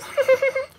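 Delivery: a high, delighted burst of laughter; high squeal of laughter. Real, not acted. Laughing with viewers at own impression. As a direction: crack up at your own bit.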